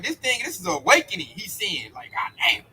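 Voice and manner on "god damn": unvoiced, breathy aside of dismay; hushed mock oath of alarm; whispered curse. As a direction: whisper it like someone caught out. Esau's reaction, voiced.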